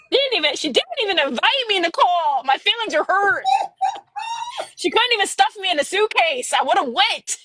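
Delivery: high-pitched, playful wounded complaint; mock hurt. Author teases her absent bestie about Disneyland, mock hurt. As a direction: whine in mock outrage at being left out.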